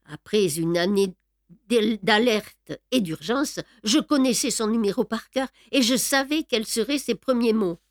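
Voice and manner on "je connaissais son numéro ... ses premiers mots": ordinary delivery, nothing marked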